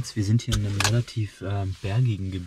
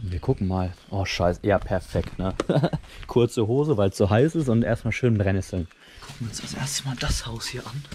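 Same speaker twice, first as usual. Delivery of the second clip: ordinary talk, nothing marked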